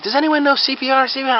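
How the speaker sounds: high, urgent bystander; mock panic. Bystanders over a collapsed man wanting help, mock panic.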